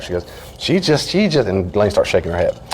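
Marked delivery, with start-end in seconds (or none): 0.6-1.5 imitated upset daughter. Voices upset daughter complaining.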